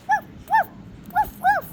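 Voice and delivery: very high little yips; squeaky chick woofs. Pretend. Mister Chick trying a bark, very high. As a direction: bark in a tiny bird's squeak. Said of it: "I'm Mister Chick".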